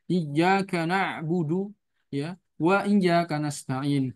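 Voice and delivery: "Iyyaka" with the doubled ya pressed, slow; stress on the ya. Shown as a demonstration. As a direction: lean hard on the doubled ya, recite slowly. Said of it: "Ada penekanan di ya-nya".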